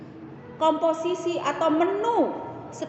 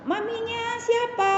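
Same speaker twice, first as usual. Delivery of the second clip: high-pitched mock questioning; retold nosy question; 8 semitones higher than usual. Another mother asks whose mother she is, high retold question.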